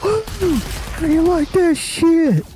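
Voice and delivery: high, slow, hammed-up crowing whoop; gleeful whoop. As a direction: crow it out like a cocky old man showing off. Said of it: "he just goes".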